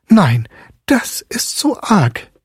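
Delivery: high, slowed dismay as the mother; fretful mother voice; upset mother. A narrator's reading. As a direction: cry out that it's too much. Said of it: "rief die Medizinalrätin".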